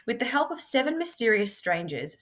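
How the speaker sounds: usual speaking voice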